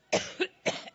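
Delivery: rough hacking cough; chesty cough. Real, not acted. Coughing through a speech.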